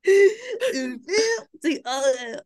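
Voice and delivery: "Un film de horreur" very slow, in a hammed-up French accent; milk it slowly, in a mock French accent. A running joke with friend, slowly in a hammed-up French accent. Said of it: "with a bit of an accent".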